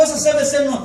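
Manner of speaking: ordinary delivery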